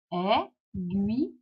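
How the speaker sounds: "Aiguille" slowly in three beats, ai... gui... lle; cut into syllables. Shown as a demonstration. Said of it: "on va découper le mot comme ça".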